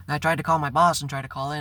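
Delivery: normal talking voice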